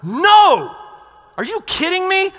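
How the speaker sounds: stern Jesus shouting No at his disciples; Jesus rebuking sternly. Retold or imitated. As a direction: shout them down in outrage. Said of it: "Jesus is going".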